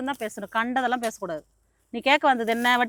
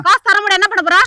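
defiant angry retort; 5 semitones higher, faster than usual. Acted. Heated quarrel over unpaid loan.

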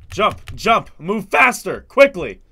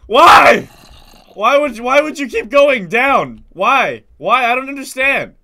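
exasperated yell; 5 semitones higher, 8 dB louder, slower than usual. Real, not acted. Yelling at the game's logic.

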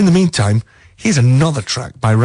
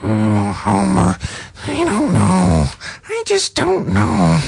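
much higher, slow, strained cartoon wife voice; bad Marge Simpson; 8 semitones higher, slower than usual. Radio host doing a deliberately bad Marge Simpson for a listener. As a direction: whine it up high like Marge. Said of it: "worst Marge Simpson impersonation".

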